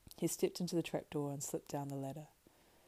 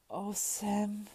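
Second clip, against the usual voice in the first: remorseful; 6 semitones higher, 3 dB louder than usual. Acted. Frodo comes to himself, remorseful.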